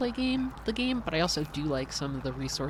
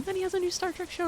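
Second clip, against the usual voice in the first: high, fast and excited; faster than usual. Real, not acted. Reading chat, high and fast.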